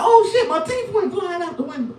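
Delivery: high, laughing panicked squeal; squeaky laughing alarm; comic panic. Retold or imitated. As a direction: realize the teeth are gone in horror. Panicked realization.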